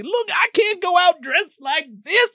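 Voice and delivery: high, slow whiny voice; mock pleading. Candidate begging his manager, high and slow. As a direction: drag it out, pleading.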